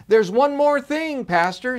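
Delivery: high, loud eager call; excited call to the departing pastor. A narrator's reading. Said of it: "she said excitedly".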